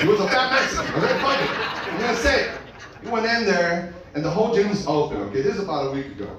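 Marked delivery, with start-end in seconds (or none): 0.0-2.5 defiant, owning it. Shown as a demonstration. Comic to crowd, says it boldly after hesitating, defiant. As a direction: go for it, commit to the line.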